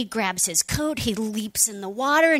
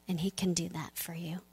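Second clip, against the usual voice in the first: soft whisper; 3 semitones lower, 13 dB quieter than usual. Real, not acted. Preacher's intimate close.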